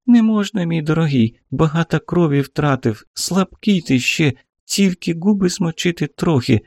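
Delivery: high, caring woman's voice. A narrator's reading. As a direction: soothe a wounded man gently. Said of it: "заботливо ответила Марфа".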